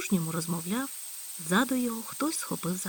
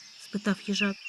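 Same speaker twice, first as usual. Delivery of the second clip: ordinary delivery, nothing marked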